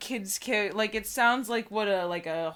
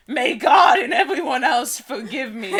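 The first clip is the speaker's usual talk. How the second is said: louder, higher, laughing through the grand line; mock dramatic reading; 4 semitones higher, 12 dB louder than usual. Reading a melodramatic book line to co-host, laughing. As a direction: ham up the solemn plea while cracking up.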